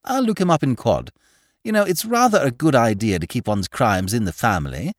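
lightly breezy, lilting chat; cheerful. A narrator's reading. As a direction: toss it off with mild cheer. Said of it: "said Lord Peter cheerfully".

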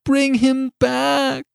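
high, slow, drawn-out cry; soaring desperate demand. A narrator's reading. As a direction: wail the command, stretching each word. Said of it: "cried the old woman".

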